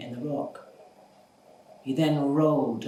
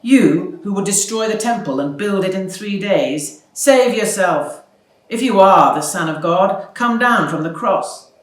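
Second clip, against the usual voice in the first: loud jeering taunt, pitched higher; passers-by shouting mockery at the crucified man; mocking jeer; 4 semitones higher, 10 dB louder than usual. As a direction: sneer it loudly up at the man on the cross. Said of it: "shaking their heads and saying".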